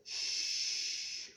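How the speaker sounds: long rushing shh; wind in trees. Acted. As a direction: imitate wind through branches. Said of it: "of the wind in the trees".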